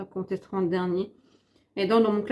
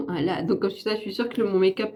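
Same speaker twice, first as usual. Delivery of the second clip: ordinary talk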